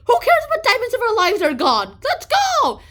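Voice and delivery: high, loud call to flee; urgent shout. Retold or imitated. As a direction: yell at everyone to run. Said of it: "They're like".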